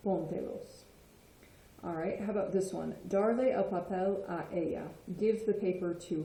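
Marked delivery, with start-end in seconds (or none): 0.1-0.8 Póntelos stressed on its first syllable, PÓN. Shown as a demonstration. Teacher showing where the accent falls, stress on the first O of Póntelos. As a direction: hit the PÓN of Póntelos, let te-los follow lightly. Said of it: "put it on the O in this case".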